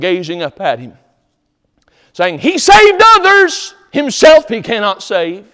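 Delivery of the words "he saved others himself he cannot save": high, slow jeering sneer; mock them from below, drawn out and high; mocking crowd. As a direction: sneer it up at the cross. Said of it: "That's mocking".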